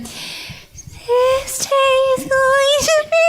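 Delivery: very high, slow sugary princess voice; sweet fairy tale princess, way up high; Princess Cadence impression. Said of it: "here's my Princess Cadence impression".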